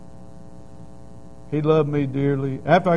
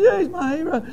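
laughing; 14 semitones higher, 3 dB louder than usual. Retold or imitated. Laughing about his hero.

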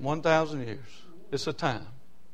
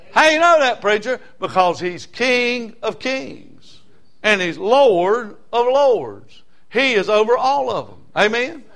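raised, high pulpit voice; loud, emphatic sermon declaration; full-force preaching; 8 semitones higher, 7 dB louder, slower than usual. Real, not acted. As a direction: call it out and stretch the titles slowly.

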